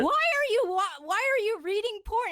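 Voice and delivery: high-pitched mock-scandalized question; shocked confrontation. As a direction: confront them in playful disbelief. Retold shocked confrontation of boyfriend.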